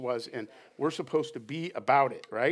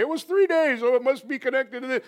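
over-spiritualizer; 9 semitones higher, 5 dB louder than usual. Retold or imitated. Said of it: "We don't wanna, like, over-spiritualize everything".

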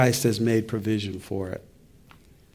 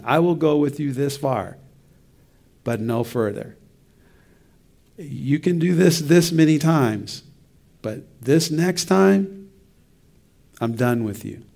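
rising to a higher, louder fed-up warning; a person running out of patience; exasperated human; 3 semitones higher, 3 dB louder than usual. Retold or imitated. Imitating a fed-up human setting a last limit. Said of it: "from a human being standpoint".

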